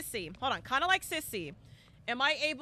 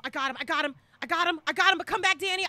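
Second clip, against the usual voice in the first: normal talking voice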